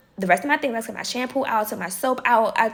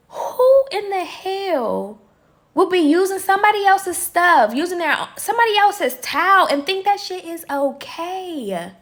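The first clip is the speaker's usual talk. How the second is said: retelling her outrage at the stolen towel; outraged rant; 7 semitones higher than usual. Retold or imitated. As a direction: fume at whoever used your towel. Outraged at someone using her things.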